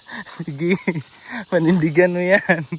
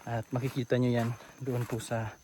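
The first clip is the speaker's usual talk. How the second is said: vlog host narration; 5 semitones lower, 12 dB quieter than usual. Acted. Addresses viewers like a host.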